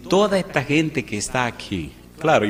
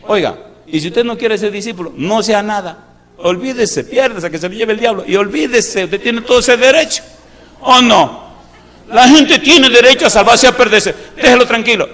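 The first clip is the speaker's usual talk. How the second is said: higher and much louder, thundering from the pulpit; fiery preaching; 9 semitones higher, 10 dB louder than usual. Acted. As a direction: blast it out at the congregation, half mocking. Preaching at full force. (predicación a todo pulmón)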